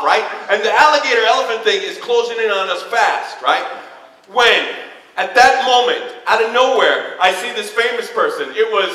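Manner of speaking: ordinary delivery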